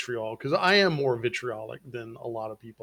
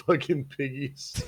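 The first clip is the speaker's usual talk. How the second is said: laughing; 2 semitones higher than usual. Real, not acted. Laughing at the pig video.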